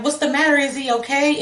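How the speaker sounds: mild retold alarm. Mother asking the man if her son is okay, worried.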